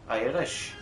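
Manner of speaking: slow, lightly put-on Irish accent. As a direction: toss in a half-hearted Irish accent. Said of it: "I don't know why I'm putting on an accent".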